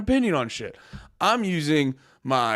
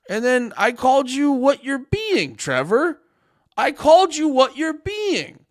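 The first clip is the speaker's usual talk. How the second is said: angry; 7 semitones higher, slower than usual. Real, not acted. Heated argument with chatter.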